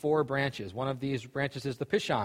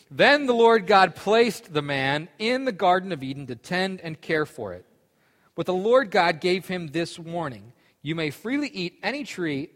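steady Bible reading voice; scripture reading; 4 semitones higher, 3 dB louder than usual. Acted. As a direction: read it like scripture to a group. Reads scripture aloud.

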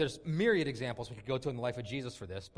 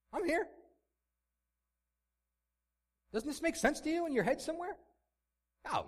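mock soothing Jesus; 3 semitones higher, 3 dB louder than usual. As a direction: parody a preachy, patronizing comforter. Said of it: "Jesus doesn't come alongside them and say".

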